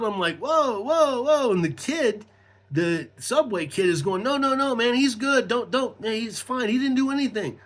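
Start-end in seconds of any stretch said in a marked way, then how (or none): 0.4-1.5 high-pitched protesting whoas; alarmed. Retold or imitated. Facing sheriffs with guns drawn, alarmed. 4.2-7.6 high, urgent intervening voice; pleading Subway kid. Retold or imitated. Subway kid vouching for him to the cops, pleading.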